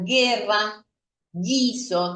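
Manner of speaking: "guerra, guiso" sin pronunciar la u; u muda. Shown as a demonstration. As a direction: decir "guerra" como gerra con g suave. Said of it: "decimos que es una U muda". (u muda en "guiso")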